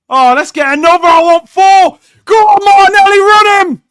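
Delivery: high, full-volume urging; excited screaming. Real, not acted. Fan urging Martinelli to run, excited screaming. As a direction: roar the player forward at the top of your voice.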